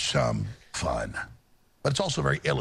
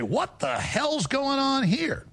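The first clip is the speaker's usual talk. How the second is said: high, slow, stunned; like snapping out of a trance; waking-up outrage; 12 semitones higher, much slower than usual. Retold or imitated. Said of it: "and you say".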